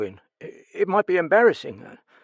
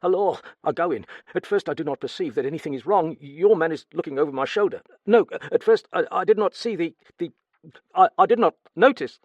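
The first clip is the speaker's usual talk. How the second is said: fast, much higher, louder panicky account; frantic fast retelling; 10 semitones higher, 9 dB louder, faster than usual. Acted. Steward reliving finding the body, panicked and fast. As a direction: rush through it, terrified. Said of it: "began to speak very fast".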